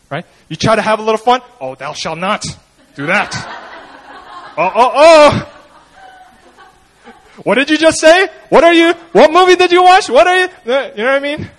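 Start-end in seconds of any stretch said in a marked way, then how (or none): 1.6-3.3 soft, prissy mock scolding; a lightly voiced mock killjoy brother. Mocking killjoy older brother. 4.6-10.4 high, loud mock outrage; mock outrage from a self-righteous brother; scandalized brother. Mocking scandalized older brother.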